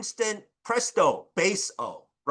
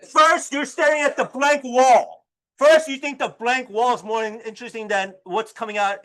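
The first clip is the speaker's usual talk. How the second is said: loud, raised-pitch teacherly telling-off; high, forceful mock indignation; mock scolding; 4 semitones higher, 5 dB louder than usual. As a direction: scold the class in playful outrage. Teacher mock-scolds inattentive students.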